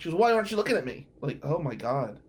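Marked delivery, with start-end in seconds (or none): 0.2-1.1 higher, louder accusing demand; mock demanding whine. Ex snapping at him over lunch, mock demanding. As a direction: imitate the ex's pushy challenge.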